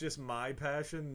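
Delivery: ordinary talk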